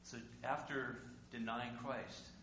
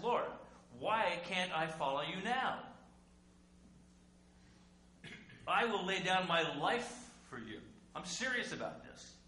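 loud earnest vow; 6 semitones higher, 8 dB louder than usual. Acted. Preacher voicing Peter's vow.

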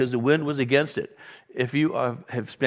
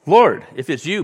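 Peter calling out; 4 semitones higher, 8 dB louder than usual. Retold or imitated. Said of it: "Peter replied".

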